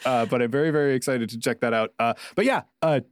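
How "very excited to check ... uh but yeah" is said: ordinary delivery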